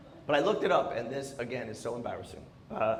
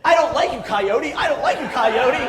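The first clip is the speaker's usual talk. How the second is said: high, loud shouted taunt; mock yell; 12 semitones higher, 13 dB louder than usual. Imagined testers yelling at a coyote, a high loud taunt. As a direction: yell it at the coyote like a testing guy. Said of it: "guys out there going".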